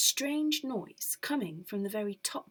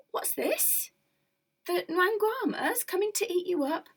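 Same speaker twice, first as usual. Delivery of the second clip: higher, curious peddler; a hint of a cheery peddler; lightly voiced peddler; 9 semitones higher than usual. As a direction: ask with surprise.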